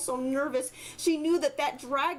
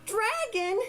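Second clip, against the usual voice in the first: yelling out; 10 semitones higher, slower than usual. Acted. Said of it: "and yelled out".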